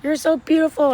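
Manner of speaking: high, adoring gush; gushing greeting. Retold or imitated. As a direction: gush at meeting her. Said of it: "I was like".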